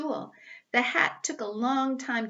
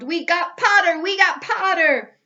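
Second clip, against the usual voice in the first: much higher, much louder triumphant yell; twins yelling; 8 semitones higher, 11 dB louder than usual. Acted. The Weasley twins cheer Harry's sorting, yelling. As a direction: cheer it across the hall. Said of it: "the Weasley twins yelled".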